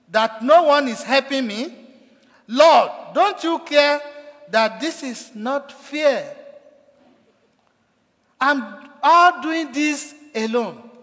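loud, high, slow complaint; frustrated outcry. Retold or imitated. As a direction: pour out resentment at being left alone. Preacher voicing frustrated Martha.

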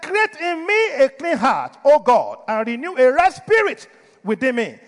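loud, high acted plea to God; pleading prayer. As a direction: cry out in prayer as the penitent king. Voices David pleading with God.

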